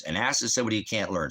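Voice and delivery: ordinary talk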